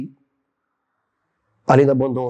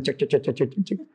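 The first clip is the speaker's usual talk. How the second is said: repeated splashy "tchac" fading softer; imitated paddling noise; 2 semitones lower, 6 dB quieter than usual. Dog swims through flooded kitchen, imitated splashing sound. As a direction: mimic little paws paddling through water.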